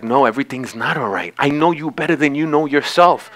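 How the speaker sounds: low, hushed, intimate correction; softly and firmly contradict, as God speaking close; quiet insistence. Acted. God gently contradicting the hurting person.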